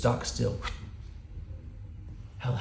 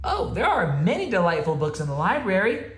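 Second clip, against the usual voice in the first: reassuring; 8 semitones higher, 5 dB louder than usual. Acted. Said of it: "Mouse assured him".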